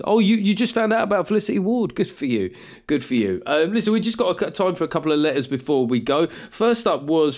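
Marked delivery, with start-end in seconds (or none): 0.0-3.4 higher, mock-surprised then dropping patronising; smug condescension. Smug fan to a latecomer, condescending. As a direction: put them down smugly, pitch falling away.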